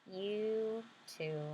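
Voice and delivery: soft generous little sister; gentle sister. Acted. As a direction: offer it gently, sharing. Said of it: "guess what my little sister said".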